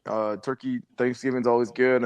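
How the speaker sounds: ordinary talk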